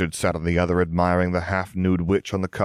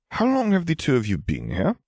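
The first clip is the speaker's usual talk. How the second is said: sharp, high demanding question; 9 semitones higher than usual. A narrator's reading. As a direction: demand an answer, pressing hard. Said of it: "Harry demanded".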